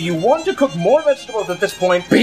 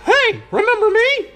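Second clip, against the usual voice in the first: squeaky cartoon voice; 12 semitones higher than usual. High squeaky voice for beer.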